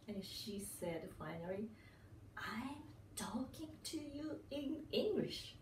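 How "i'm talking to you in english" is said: slow, high-pitched retold protest; drawn-out, higher, mildly exasperated imitation; mock exasperation. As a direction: imitate a caller spelling out the obvious. Caller's final exasperated reply retold.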